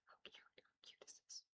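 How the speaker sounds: breathy whisper of delight over the pup. Real, not acted. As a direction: hush it, as if not to wake him.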